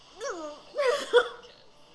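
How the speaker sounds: high loud laugh; real high-pitched laugh; burst of laughter. Real, not acted. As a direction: let a big high laugh out. Laughs at the show.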